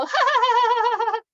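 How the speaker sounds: high, loud ha ha from the gut; belly laugh. Shown as a demonstration. Teacher demonstrates belly laughter to the class. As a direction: laugh hard so the belly pumps. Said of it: "When you just go".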